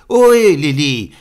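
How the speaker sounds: calling out at full voice, high and loud; hurling a name. Acted. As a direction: hurl it toward the heights. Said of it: "J'ai un petit peu la voix aussi". (appel hurlé, très fort et aigu)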